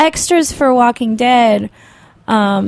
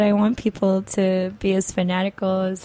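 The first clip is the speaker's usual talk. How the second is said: ordinary talk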